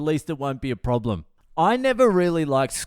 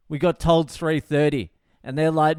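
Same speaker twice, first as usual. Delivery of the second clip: ordinary talk, nothing marked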